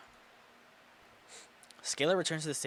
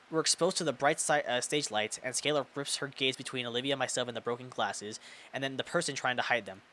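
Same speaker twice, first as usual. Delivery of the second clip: ordinary delivery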